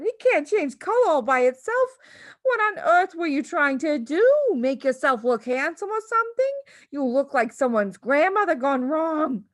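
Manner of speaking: mock the husband scornfully. A narrator's reading. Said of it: "the mother cried".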